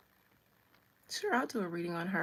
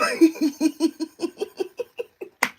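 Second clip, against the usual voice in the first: long, high burst of laughter; high loud cackle; 8 semitones higher, 11 dB louder than usual. Real, not acted. Streamer cracking up at her own imitation, high cackle. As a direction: break up into a loud rising laugh.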